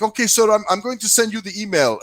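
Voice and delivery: imitated service rep. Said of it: "she's like".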